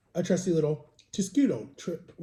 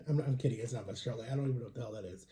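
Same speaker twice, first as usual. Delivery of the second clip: quiet aside; 7 semitones lower, 10 dB quieter, faster than usual. Real, not acted. Joke aside, fading.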